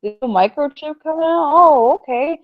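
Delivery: high, bright mock amazement; imitating naive people marvelling at gadgets; mock clueless human. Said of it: "They be like".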